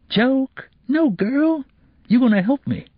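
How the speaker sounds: high scoffing street voice; mocking tough boy. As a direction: brush her off, then command. Kevin scoffing, commanding.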